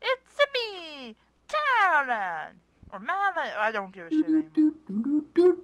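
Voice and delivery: a hint of an old farmer; lightly voiced Talon. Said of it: "there's a character named Talon".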